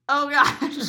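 loud, high mock dismay; flustered reaction. Usher reacting to Kevin crying, hammed-up loud dismay. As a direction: blurt it out high and loud, hamming up the alarm.

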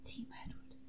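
soft whispered backing line; mock-sultry whisper; whispered echo.

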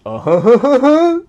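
high, loud put-on cackle; hammed-up TV celebrity laugh; mock Seki laugh. Friend mimicking Seki's new laugh, loud and high. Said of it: "みたいな".

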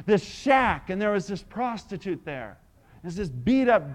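ordinary talk

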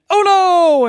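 loud dismayed cry; shouting. Acted. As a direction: cry out as the ball sinks. Golfers yelling in story.

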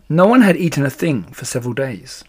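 plain reading voice

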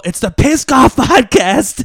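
loud, slow hype announcer. Pretend. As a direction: boom out the show name. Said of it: "announcing the show name again".

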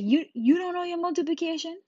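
mock scolding, high and loud; incredulous teacher. Teacher to silent pupil, high incredulous scolding. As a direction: push the question up high in disbelief.

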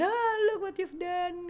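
high, slow playful accusation; mock complaint. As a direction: whine it at him, drawn out. Acts out tearful complaint to him.

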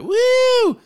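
very high-pitched squealed whoop; mock excited scream. Co-host imitates roommates greeting each other, mock excited scream. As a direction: imitate girls shrieking on reuniting. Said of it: "they scream and be like".

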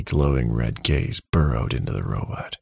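ordinary delivery, nothing marked

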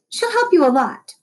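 high teacher voice; Miss Corgi. A narrator's reading. Teacher assuring Noah about Lexi, high voice. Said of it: "Miss Corgi added".

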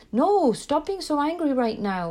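imitating a panicked parent snapping at a child; anxious parent scolding. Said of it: "run scared and go into fear".

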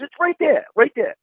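mock the clerk pointing it out; retold insistence.

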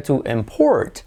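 to imPORT, weight on PORT; stress on second syllable. Shown as a demonstration. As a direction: lean on PORT in import, as the verb. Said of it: "como verbo sería". (import con acento en la segunda sílaba)

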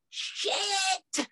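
high startled swear; mock outburst. Actor interrupted by phone mid-take, high yelp. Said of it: "me going".

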